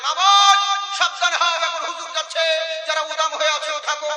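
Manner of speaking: hammed-up loudspeaker warning; mock mic announcement. Preacher mocking a loudspeaker call to the women, laughing. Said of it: "মাইকে করে".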